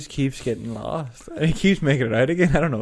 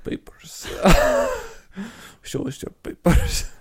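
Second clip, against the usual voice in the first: put-on German accent; slower than usual. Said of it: "he turns around and he goes".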